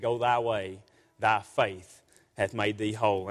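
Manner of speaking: low, slow and grave, like scripture spoken by Jesus; a lordly pronouncement, deep and unhurried; solemn voice of Jesus. Acted. Preacher voicing Jesus' KJV words.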